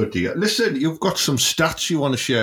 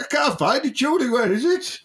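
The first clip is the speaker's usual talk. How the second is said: pitched way up, playing lost; high mock helpless voice; 11 semitones higher than usual. Joking about searching for Julie's Pookie, high helpless voice. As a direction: squeak it like a lost little man. Voicing himself searching, comic.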